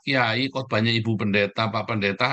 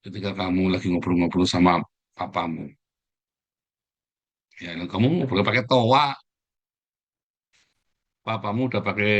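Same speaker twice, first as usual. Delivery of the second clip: ordinary talk, nothing marked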